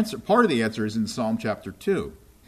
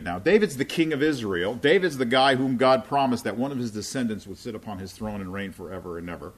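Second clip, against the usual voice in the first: normal talking voice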